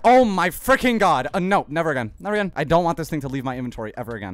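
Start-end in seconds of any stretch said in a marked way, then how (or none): 0.0-2.5 loud relieved outburst. Real, not acted. Relief after item recovered.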